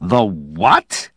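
incredulous question with a sharp final rise; loud disbelief. A narrator's reading. Dowland questioning Slade's claim, incredulous. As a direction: swoop up in astonishment on the last word. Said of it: "Dowland said loudly".

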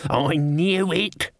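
slow, high-pitched indignant cry; restrained old servant's outburst; mild shout. A narrator's reading. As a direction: exclaim it drawn-out, without much volume. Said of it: "Albert shouted".

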